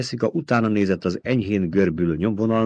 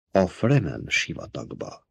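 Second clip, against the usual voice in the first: softer and slow, hushed; whispered; 2 semitones lower, 7 dB quieter, slower than usual. A narrator's reading. Paul adding quietly about the Fremen desert, hushed. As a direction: murmur it under the breath. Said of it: "tette hozzá suttogva Paul".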